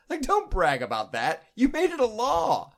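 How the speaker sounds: high, loud exasperated outburst; indignant rant. Real, not acted. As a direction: rant at the bragging, half-laughing.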